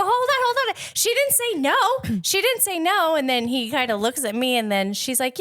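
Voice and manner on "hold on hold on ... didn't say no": urgent hold on. Retold or imitated. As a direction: jump in quickly to stop him, high and eager. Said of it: "I go".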